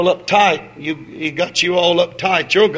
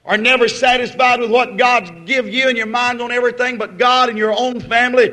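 loud, high, driving sermon; fiery revival preaching; preaching at full force; 7 semitones higher, 5 dB louder than usual. Real, not acted. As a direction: preach it hard to the congregation.

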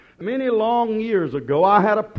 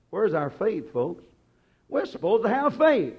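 ordinary delivery

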